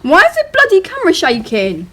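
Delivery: loud, high exasperated outburst; loud irritated complaint; frustrated. Real, not acted. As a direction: vent at the camera. Frustrated at shaking camera.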